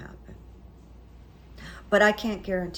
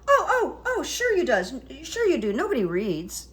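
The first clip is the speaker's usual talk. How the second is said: much higher, louder, gushing; mock eager Stigler; 7 semitones higher, 4 dB louder than usual. Publisher agreeing that nobody reads, high eager voice.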